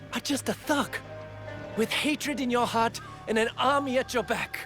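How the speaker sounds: high, heated condemnation; indignant, pitched well up; angry denunciation. Acted. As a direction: spit the accusation at your enemy. Denouncing the enemy.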